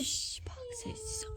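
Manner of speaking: hushed shushing whisper; whispering while hiding. Pretend. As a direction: hush the child, someone's near.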